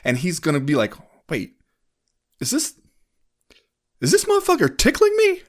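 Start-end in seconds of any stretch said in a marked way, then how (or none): 1.3-5.4 very high-pitched, halting mock disbelief; acted bafflement. Fighter realizing his opponent is tickling him, acted high-pitched bafflement. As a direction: play the baffled fighter, pausing, then squeaking up high. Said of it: "in a state of total bafflement".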